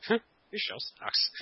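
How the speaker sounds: laughing. Real, not acted. Teasing co-host, laughing.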